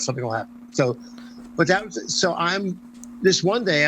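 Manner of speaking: normal talking voice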